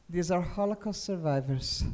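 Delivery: ordinary delivery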